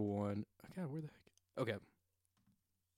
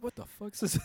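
mock startled; 8 semitones higher than usual. Waking with snail on forehead.

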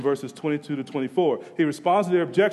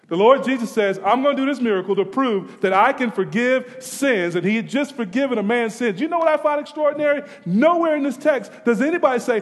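louder, higher pulpit voice; impassioned preaching; 7 semitones higher, 4 dB louder than usual. Acted. Preacher stirring the congregation, impassioned. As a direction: preach it with mounting fire.

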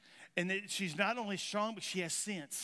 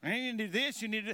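high mocking singsong orders; mocking his wife's orders while fighting the tree; nagging wife voice; 6 semitones higher, 4 dB louder than usual. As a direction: parrot her bossy little voice back. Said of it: "this little voice over here going".

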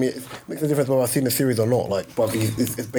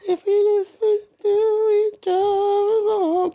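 put-on voice; much slower than usual. Sudden stretched put-on voice.

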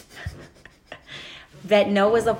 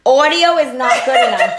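laughing; 5 semitones higher, 9 dB louder than usual. Real, not acted. Laughing hard at the candle mishap.

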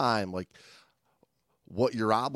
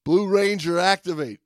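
loud, high mock hero cry; superhero call-out; 8 semitones higher, 7 dB louder than usual. Host joining the Power Rangers joke, loud mock-heroic call. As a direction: announce it like a cartoon hero powering up.

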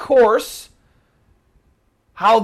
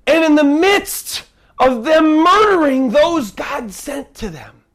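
high, loud pleading sermon voice; fervent preacher's cry; impassioned preaching; 7 semitones higher, 6 dB louder, slower than usual. Acted. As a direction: preach it out like a plea. Preaching at full force.

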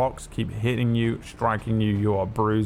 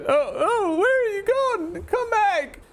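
very high, wailing plea; panicked calling. Acted. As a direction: call desperately after the one leaving you behind. GM acts abandoned Ezra calling out.

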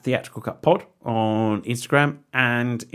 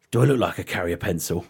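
Statham impression; 6 semitones lower than usual. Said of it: "he just looks at him and goes".

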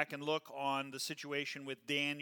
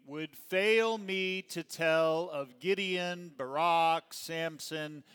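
slow, loud, solemn Bible reading; slow, raised reading voice; scripture reading; 3 semitones higher, 3 dB louder, slower than usual. Acted. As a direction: proclaim each phrase slowly to the class. Reads scripture aloud.

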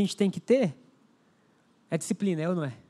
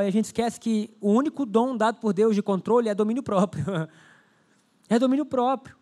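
ordinary delivery

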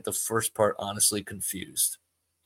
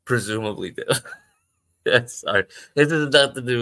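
laughing; 2 semitones higher, 7 dB louder than usual. Real, not acted. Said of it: "I'm laughing".

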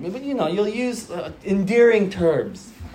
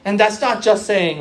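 ordinary delivery

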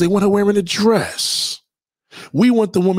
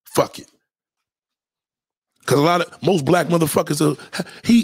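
ordinary talk, nothing marked

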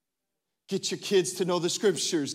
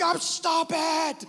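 mock yell; 13 semitones higher, 5 dB louder, much slower than usual. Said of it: "I'm, like, yelling".

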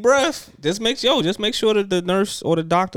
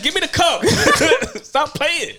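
higher, laughing begging voice with a touch of a put-on patient; laughing mock plea; 9 semitones higher than usual. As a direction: beg the nurse through giggles, hammed-up. Friend imitates a desperate patient.

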